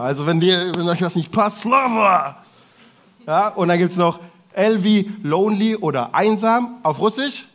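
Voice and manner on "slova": angry shout. Shown as a demonstration. Shouting demo angry word.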